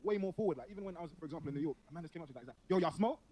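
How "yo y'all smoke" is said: raised pitch and volume, retold challenge; pushy stranger. Man in New York comes up to him, imitated pushy questioning. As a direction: imitate a forward stranger accosting someone.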